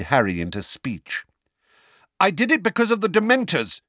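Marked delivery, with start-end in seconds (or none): 2.2-3.8 fast, high, loud blurt; loud outburst. A narrator's reading. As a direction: burst out before anyone interrupts. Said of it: "he said loudly".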